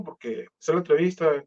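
ordinary talk

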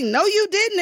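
high-pitched, sassy mock disbelief; animated back-and-forth. Co-stars argue over who risks getting fired, high and animated. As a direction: act out two co-stars bickering. Acted heated exchange with Whoopi.

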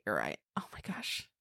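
breathy whisper of amazement; a hushed, stunned whisper; whispered awe. Real, not acted. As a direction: whisper it in disbelief. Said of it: "whispered amazement".

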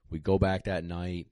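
ordinary talk

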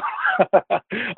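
high, rapid giggle; amused chuckle. Real, not acted. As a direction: laugh lightly at your own remark. Amused at own aside.

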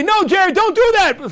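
high, pretend call to the player; pleading shout. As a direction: plead with Jared through the screen. Pleading with the TV replay.

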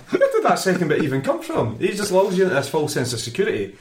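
normal talking voice

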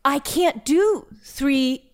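high, exasperated mock whine; whiny complaint. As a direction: complain like a fed-up employee. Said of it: "the joke for me at work when I was there during this time was like".

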